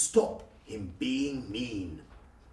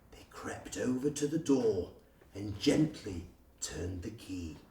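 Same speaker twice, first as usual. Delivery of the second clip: ordinary delivery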